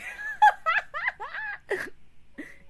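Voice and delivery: high laugh close to a cry; squealing laugh; shrieking laugh. Real, not acted. Laughs at own joke.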